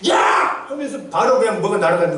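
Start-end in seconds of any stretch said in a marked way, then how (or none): 0.1-0.7 sudden shouted outburst; acted furious yell at a child; angry parent. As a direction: shout like a parent losing their temper. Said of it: "머리가 화가 나는데".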